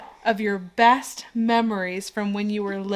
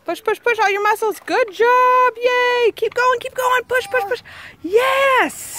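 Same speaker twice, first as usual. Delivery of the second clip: cheering coach; 12 semitones higher, 4 dB louder than usual. Real, not acted. Mom coaches child's exercise.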